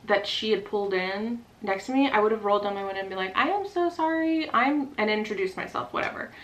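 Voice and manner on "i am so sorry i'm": imagined apology. Retold or imitated. Imagined friendly apology to neighbor.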